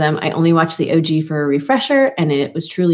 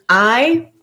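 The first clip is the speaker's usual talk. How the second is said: usual speaking voice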